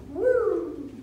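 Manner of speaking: high rising-falling "woo" siren; whooped siren noise; police siren whoop. Retold or imitated. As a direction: imitate a cop car's siren.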